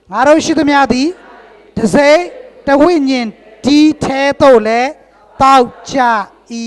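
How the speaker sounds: loud, ringing scripture read-aloud; loud group recitation. Real, not acted. Preacher leading the church in loud recitation. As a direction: proclaim each phrase loudly to the hall.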